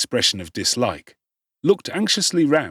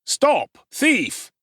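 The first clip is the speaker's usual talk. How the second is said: shouting after a thief; 14 semitones higher than usual. Acted. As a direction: yell it out to stop him. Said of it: "and shouting".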